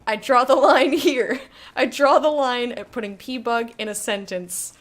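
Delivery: loud, laughing protest; laughing refusal. Real, not acted. Streamer refusing to say pee bug, laughing loudly. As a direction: refuse while cracking up. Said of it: "I can't even finish a sentence".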